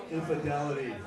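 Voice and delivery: very low, understated brand-name read; bank slogan voice. Acted. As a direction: intone it like an investment bank's tagline. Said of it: "That sounds like a bank".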